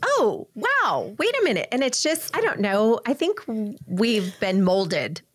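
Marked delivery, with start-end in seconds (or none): 0.0-1.7 high, wide-swooping wows of discovery; amazed realization. Retold or imitated. Co-host recalls having her mind blown by Clubhouse, amazed. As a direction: act out a dawning, delighted surprise.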